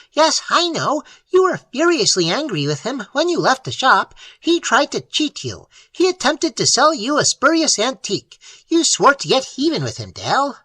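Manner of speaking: high, shrill imp voice, gloating; shrill familiar. Acted. Familiar reminding Dale of his grudge, high and shrill. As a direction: needle him with cold logic. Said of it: "The little Baroque shrilled".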